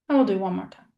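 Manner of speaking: usual speaking voice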